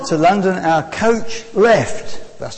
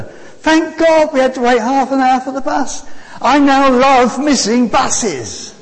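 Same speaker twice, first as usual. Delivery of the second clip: high, loud, jubilant exclamation; exultant; 7 semitones higher, 5 dB louder than usual. Real, not acted. Preacher rejoices to congregation over missed bus, exultant. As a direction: proclaim it with delight, slowing at the end.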